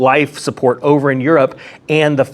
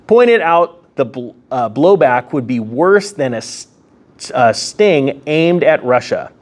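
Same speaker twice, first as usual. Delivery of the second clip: ordinary talk, nothing marked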